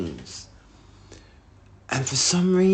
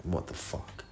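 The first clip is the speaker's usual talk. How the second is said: hushed, low retold exasperation; losing it under the breath; 7 semitones lower, 14 dB quieter than usual. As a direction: mutter it very softly in disbelief. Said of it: "I lose my shit".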